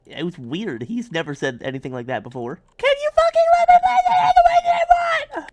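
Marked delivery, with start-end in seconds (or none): 2.8-5.5 extremely high, piercing scream; full-force shrieked demand; screamed tantrum. Acted. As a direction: scream it like a tantrum, all on one pitch. Mock tantrum at the game.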